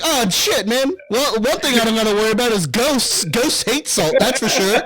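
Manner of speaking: lightly hammed-up inner voice; mock musing. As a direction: muse as if relieved, half joking. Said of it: "you're like".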